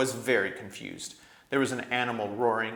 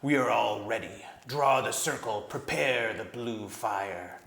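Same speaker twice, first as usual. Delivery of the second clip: gray voice. A narrator's reading. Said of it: "said the gray voice".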